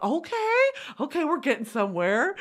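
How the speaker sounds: high, lilting okay, rising at the end; surprised approval. Retold or imitated. Reader sees the man improving, pleasantly surprised. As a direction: perk up with cautious approval.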